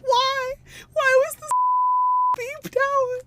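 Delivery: high, indignant disbelief; mock outrage. Real, not acted. Streamer indignant at the censor beep, high and outraged. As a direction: squeak out why it got bleeped.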